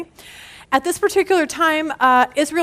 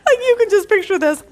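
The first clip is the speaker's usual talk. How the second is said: laughing; 7 semitones higher, 4 dB louder than usual. Real, not acted. Still laughing at the story.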